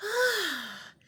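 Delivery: Bridget relieved. Acted. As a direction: sink into the warm water. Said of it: "she said".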